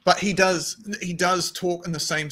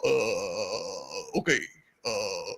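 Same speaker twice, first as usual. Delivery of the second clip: low, halting Obama presidential voice; Obama impression; 8 semitones lower, 6 dB quieter than usual. Podcaster demos Obama voice for friends. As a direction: drop low and pause like Obama. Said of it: "He does that".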